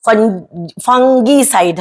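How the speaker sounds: फंगीसाइड drawn out with a hard "g"; slow, hard "g" in फंगीसाइड; hard g pronunciation. Shown as a demonstration. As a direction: slowly say फंगीसाइड as "fung-gee-side". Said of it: "they say".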